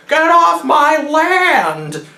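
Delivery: put-on high, slow, cranky old woman yelling; old lady shouting. Old lady with a shotgun ordering intruders off, high mock yell. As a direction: shoo them off like an angry granny.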